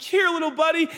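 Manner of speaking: high sweet dog-owner voice; cooing, high-pitched invitation; coaxing a pet. Acted. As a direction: call a puppy over in high baby talk. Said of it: "I'm like".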